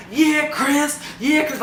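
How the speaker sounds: high, excited mock cheer; imitated cheering boyfriend. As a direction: whoop it like a proud boyfriend in the crowd.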